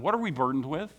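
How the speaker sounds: ordinary talk, nothing marked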